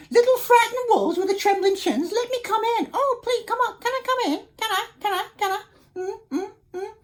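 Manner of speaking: high squeaky pleading pig voice; a pig begging at the wolves' door, high and wheedling. Acted. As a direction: wheedle sweetly to be let in, way up high.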